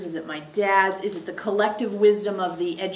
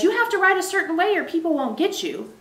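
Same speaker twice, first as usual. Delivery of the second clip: higher, lightly mocking inner critic; critical inner voice; 7 semitones higher than usual. Coach voicing the client's inner critic, lightly mocking. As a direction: voice the nagging rule in a raised pitch. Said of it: "not my voice says".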